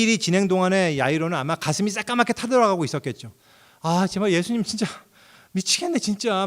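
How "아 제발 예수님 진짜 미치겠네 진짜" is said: higher, pleading mock frustration; anxious frustration. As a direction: act out a father losing patience. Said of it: "막 이러면서". (애타는 척 답답한 목소리)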